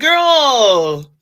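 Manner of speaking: high, very loud drawn-out shout; loud, exasperated holler; exasperated yell. Real, not acted. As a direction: yell it out at your rival. Ranting at rival after mockery.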